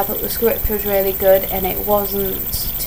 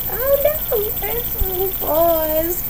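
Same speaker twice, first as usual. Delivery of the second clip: mock dismay; 12 semitones higher, much slower than usual. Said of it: "I was like".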